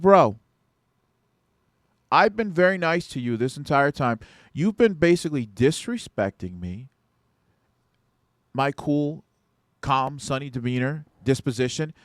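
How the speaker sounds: stern telling-off, still in control. Retold or imitated. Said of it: "I said it just like this to him".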